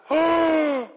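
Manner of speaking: loud, high mock gasp; hammed-up horrified gasp; shocked gasp. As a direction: gasp as if scandalized.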